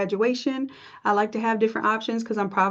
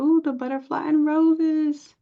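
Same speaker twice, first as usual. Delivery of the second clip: higher, drawn-out cooing admiration; delighted admiration; 6 semitones higher than usual. Real, not acted. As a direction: coo slowly over something lovely. Admiring students' patterns on the server.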